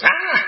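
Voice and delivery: high-pitched mock yelp of fright; sudden high "Ah!"; imitated startled cry. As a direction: imitate someone jumping out of their skin. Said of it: "but my wife goes".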